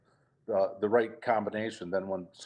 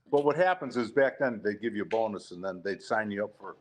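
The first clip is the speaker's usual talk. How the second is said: ordinary talk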